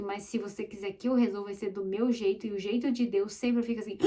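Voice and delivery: usual speaking voice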